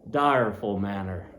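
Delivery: plain reading voice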